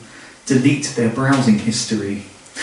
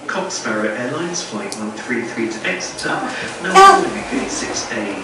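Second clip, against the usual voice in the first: softer, even tannoy announcer read; airport announcement; 4 semitones lower, 6 dB quieter than usual. Pretend. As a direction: read it like a gate call over the speakers.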